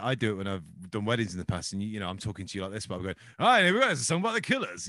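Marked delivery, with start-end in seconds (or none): 3.4-4.9 much higher and louder, a bright compère's patter; wedding host voice. Retold or imitated. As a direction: hype up the room like a wedding DJ. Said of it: "you go into that voice".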